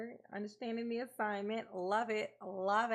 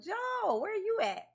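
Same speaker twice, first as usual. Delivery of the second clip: calling out; 11 semitones higher, 3 dB louder than usual. Real, not acted. Calling out to viewer.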